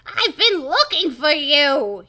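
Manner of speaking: loud, slow cartoon professor voice; Professor Shock. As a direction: boom it out slowly as the professor. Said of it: "Professor Shock".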